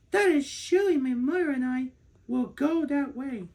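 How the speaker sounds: put-on a little girl sure she's found her mother; little girl's voice. A narrator's reading.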